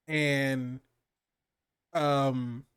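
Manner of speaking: ordinary delivery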